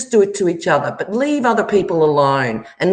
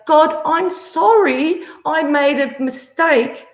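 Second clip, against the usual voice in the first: higher, slow mock plea to God; mock whiny apology; 7 semitones higher than usual. Mocking the troll begging God for forgiveness, slow and high. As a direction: whine it out like a fake penitent. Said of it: "next time you say".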